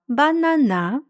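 "Банана" with the final НА lengthened and rising; stress on last syllable. Shown as a demonstration. Teacher shows learners where stress falls, final-syllable stress on "Банана". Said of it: "наголос впав".

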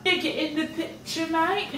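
a playful British lilt up high; mock British accent. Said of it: "hitting with the British accent".

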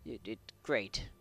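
low, soft pat-on-the-head voice; mock soothing. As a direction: comfort a hurt child, hushed and low. Soothes game character after hurt.

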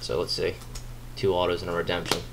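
ordinary talk

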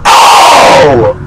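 high, loud taunting yell; excited shout. Real, not acted. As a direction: gloat loudly. Celebrating hit.

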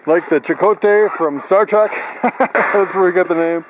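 ordinary delivery

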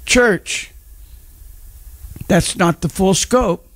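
high-pitched fervent preacher delivery; preaching. Acted. Pastor preaching to the church about being saved. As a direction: preach it with rising fire, word by word.

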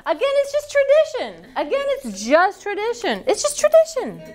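squeaky high repeat; high sing-song voice. Pretend. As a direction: say it in a high singsong.

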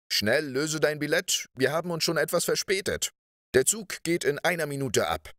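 high, pressing teacher's voice; urgent hurrying. A narrator's reading. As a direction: hurry a late pupil along. Teacher hurries the late pupil.

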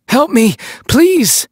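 high, slow, desperate cry for help, a different voice; desperate plea. Acted. Trapped man calling up to the rescuers, high slow plea. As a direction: call up into the lights, pleading to be saved.

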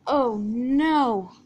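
loud, drawn-out wail of dismay; dismayed cry. Acted. Percy crashes with his trucks, dismayed cry. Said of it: "Percy cried".

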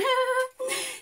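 short high giggle; giggling at her own story. Real, not acted. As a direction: giggle at the silliness. Laughs at the joke.

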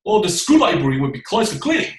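rousing, emphatic reading; impassioned. Shown as a demonstration. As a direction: declaim it with passion. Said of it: "慷慨激昂啊".

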